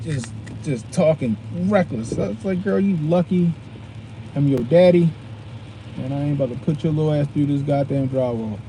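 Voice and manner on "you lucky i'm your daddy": ordinary talk, nothing marked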